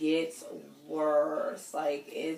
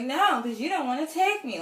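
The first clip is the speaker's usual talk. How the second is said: higher, louder bratty complaint; bratty whine; 9 semitones higher, 7 dB louder than usual. Retold or imitated. Pouting woman refusing her boyfriend, bratty whine. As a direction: sulk at him like a spoiled brat. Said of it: "I am turning into a brat".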